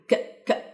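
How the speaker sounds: "ك" as a soft voiceless k burst; k sound demonstrated. Shown as a demonstration. Teacher showing the sound of both letters together, bare k. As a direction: release a bare, quiet k for each "ك". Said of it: "صوتهم بيبقى عامل كده".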